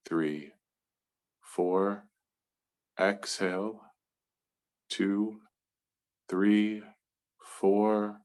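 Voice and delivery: slow, evenly spaced counting with long pauses; guided breath count. Real, not acted. Meditation leader counting the group through box breathing, slow and measured.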